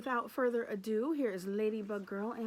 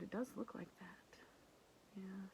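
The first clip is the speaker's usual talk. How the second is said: whispered aside; 12 dB quieter than usual. Real, not acted. Teacher whispers aside to kids.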